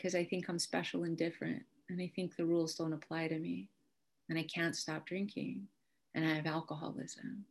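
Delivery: softly confessing to the uncle; quiet confession. Retold or imitated.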